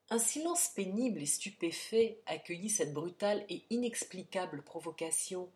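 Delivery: ordinary delivery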